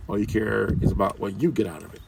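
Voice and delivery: ordinary talk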